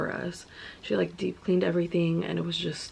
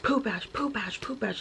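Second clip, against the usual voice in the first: retold yelling; 5 semitones higher than usual. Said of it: "she was yelling it".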